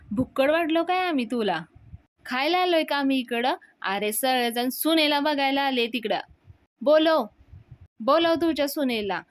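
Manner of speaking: angry rant. Retold or imitated. Sulakshana demanding Anna call the bride, angry and loud. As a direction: lash out, peaking on बोलव.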